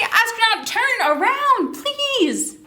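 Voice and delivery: sing-song call to the astronaut, pitched high; high, coaxing call with a polite please. Shown as a demonstration.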